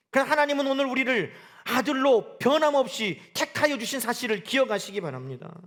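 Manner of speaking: ordinary delivery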